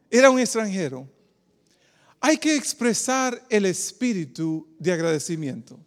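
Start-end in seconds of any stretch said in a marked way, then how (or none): none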